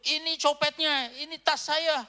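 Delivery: loud, high, slow accusation; accusing shout. Retold or imitated. American aunt accusing the pastor in the market, shouting. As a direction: point and cry out at the thief. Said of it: "ditunjuk tas".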